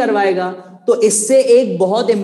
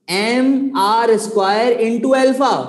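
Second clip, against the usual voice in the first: slow dictation; 3 semitones higher, slower than usual. Shown as a demonstration. Teacher dictating formula to class.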